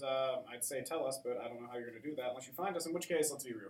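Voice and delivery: usual speaking voice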